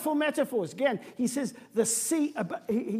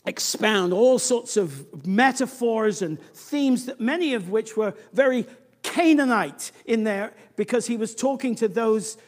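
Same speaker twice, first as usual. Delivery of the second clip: ordinary talk, nothing marked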